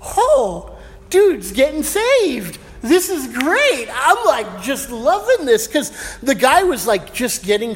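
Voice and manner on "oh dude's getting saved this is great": high, slow, delighted gush; watching the punk kid listen, thrilled and high-pitched; excited inner voice. Retold or imitated. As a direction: marvel that he's getting saved, drawn out. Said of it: "I'm sitting here going".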